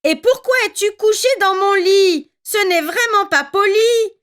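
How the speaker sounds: put-on little bear scolding the girl in his bed, high; Baby Bear exclaiming. A narrator's reading. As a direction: protest like an offended cub. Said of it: "s'exclama Bébé Ours".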